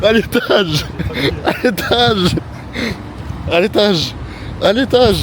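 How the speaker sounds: straining through reps; grunting out the count; doing push ups. Real, not acted.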